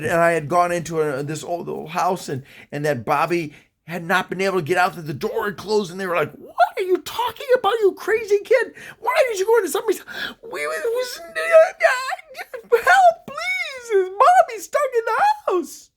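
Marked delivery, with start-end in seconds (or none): 6.3-15.9 very high, wailing, garbled outburst; hysterical. Retold or imitated. Parents questioning a crying kid who begs for help, hysterical. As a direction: act out hysteria, words dissolving into high wails. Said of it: "they could barely understand me".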